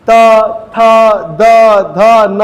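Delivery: each letter stressed for the class; demonstrating dental sounds. Shown as a demonstration. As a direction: say त, थ, द, ध, न as a careful demo. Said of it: "आप देखो".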